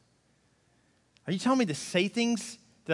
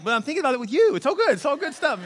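playful defense; 7 semitones higher, 4 dB louder than usual. Retold or imitated. Husband's playful defense to wife.

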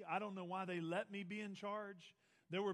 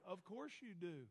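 softer, dry sarcastic aside; dry dad reply; 8 dB quieter than usual. Retold or imitated. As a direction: roll your eyes at the request. Dad's dry reply to daughter.

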